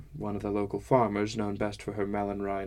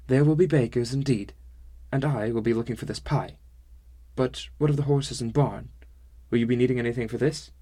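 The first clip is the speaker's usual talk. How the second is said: put-on Sumi's odd accent; 3 semitones higher, 4 dB louder than usual. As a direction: speak with a quaint, stilted accent.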